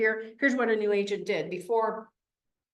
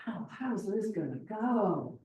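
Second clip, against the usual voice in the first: worried aside; 8 dB quieter, slower than usual. Retold or imitated. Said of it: "I'm like".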